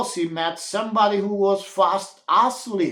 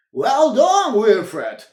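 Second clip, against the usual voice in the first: chuckling; 5 semitones higher, 6 dB louder, slower than usual. A narrator's reading. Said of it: "he chuckled".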